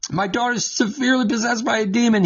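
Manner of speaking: rattled off fast and high; quick recap. Acted. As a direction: hurry through the woman's plea. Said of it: "look what happens".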